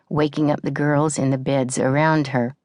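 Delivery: ordinary delivery, nothing marked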